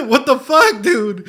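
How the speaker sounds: real disbelief at the screen; loud overwhelmed exclaim. Real, not acted. Streamer reacting to a sad scene, loud laugh-cry. Overcome watching emotional scene.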